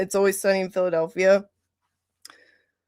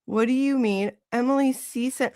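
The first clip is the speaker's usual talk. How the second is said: normal talking voice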